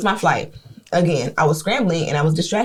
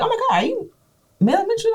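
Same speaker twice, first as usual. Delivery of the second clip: imitated starstruck fan. Hotel worker recognising her in retelling, imitated starstruck excitement. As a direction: imitate someone thrilled to recognise a celebrity.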